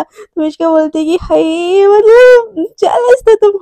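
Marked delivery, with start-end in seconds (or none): 1.2-3.6 slow, drawn-out mock swoon; gleeful teasing. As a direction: stretch "हाय" into a teasing coo. Gleefully teasing her about jealousy.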